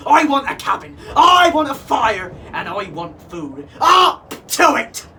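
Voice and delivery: loud, high angry demands; demanding shout. A narrator's reading. Captain roaring orders at his crew, angry shout. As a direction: bark out every want.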